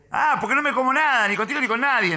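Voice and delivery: high, heated tough talk; high-pitched retold defiance; mock aggressive. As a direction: rant it like a belligerent stranger. Acted aggressor boasts angrily.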